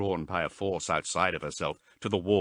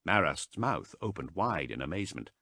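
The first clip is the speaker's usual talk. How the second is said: ordinary delivery, nothing marked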